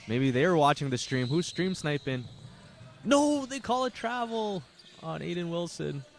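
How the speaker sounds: play-by-play announcer opening the third quarter; live play-by-play. Acted. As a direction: call the third quarter action. Live basketball play-by-play call.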